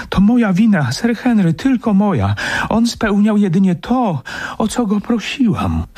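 very high, distressed female character; imploring wife, pitched far up; woman's voice. Acted. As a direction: take the blame, pleading in a high voice. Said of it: "głos kobiecy".

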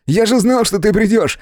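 thrilled, high-pitched welcome; excited exclamation. A narrator's reading. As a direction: gush with excitement at a brother's arrival. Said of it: "воскликнул Том".

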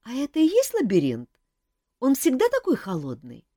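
high small bird asking; wide-eyed little chick; curious chick voice. A narrator's reading. Chick asks Kantor about the maze, high curious.

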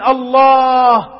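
slow, drawn-out and cried out in grief; trembling sad voice. Retold or imitated. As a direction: let the word break with sorrow as he dies. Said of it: "قال بصوت متهدج حزين". (صوت متهدج حزين)

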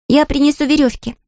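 put-on high girl's shout; Gelya shouting. Gelya volunteers to fetch ropes, eager high shout. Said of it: "закричала Геля".

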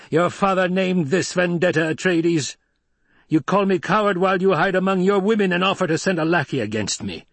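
high-pitched, heated accusation; indignant villain, pitch held high; angry challenge. A narrator's reading. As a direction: hurl the accusation at a hated rival. Shouting enemy hurls accusation at rival.

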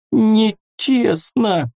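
stretched high and slow, "Нечестно" wailed; drawn-out whine. A narrator's reading. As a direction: drag out "Нечестно" in a high wail. Repeats the word, drawn out.

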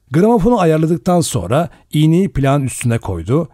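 ordinary delivery

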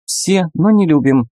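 high squeaky creature; piping little animal voice; lemur voice. Acted. Lemur answers.

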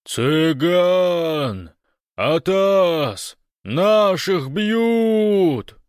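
very high, drawn-out alarm yell; bellowing. A narrator's reading. Большой calls across the vehicle park, bellowing the alarm. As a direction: bellow over a car horn, stretching the last word. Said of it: "заорал".

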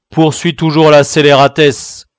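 loud, harsh snarling retort; angry outcry. Acted. Guard cutting in on the convict, loud and contemptuous. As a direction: spit the word scélératesse. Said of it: "s'écria le gardien".

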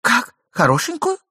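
high shrill-pitched jealous query; sharp surprise. A narrator's reading. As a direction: snap back suspicious. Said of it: "перебила жена".

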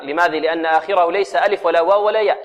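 normal talking voice

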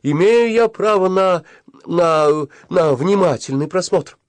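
high, loud, heated protest; insistent demand. A narrator's reading. Boy demands a proper look at the calendar, insistent.

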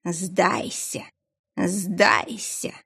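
slow, eerie voice inside her head; a dark presence speaking in the mind; telepathic voice. Acted. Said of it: "Незнакомый голос звучал прямо в голове".

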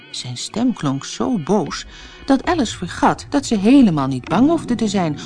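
ordinary delivery

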